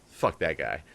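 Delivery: low, growled mock-tough aside; mock gruff grumble. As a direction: grumble it in a deep put-on voice. Mock grumpy aside about Mark.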